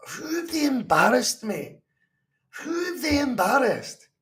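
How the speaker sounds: indignant. Real, not acted. Fan answering critics who say they're embarrassed, cracking indignation. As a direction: push back, voice cracking with upset.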